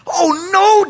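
high, loud cry of dismay. Acted. Preacher voicing David's thought, dismayed. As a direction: wail it as a hammed-up panic. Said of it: "David must have thought".

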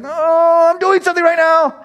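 hammed-up busy parent brushing kids off; mock annoyed dad; exasperated parent. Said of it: "I know at times it's like".

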